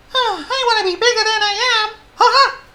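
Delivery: very high, loud wailing tiny mouse; Squeak. A narrator's reading. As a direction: squeal it as a tearful little mouse. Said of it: "said Squeak".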